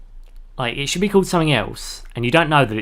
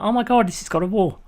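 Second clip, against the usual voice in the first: excited; 8 semitones higher than usual. Retold or imitated. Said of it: "And you're like".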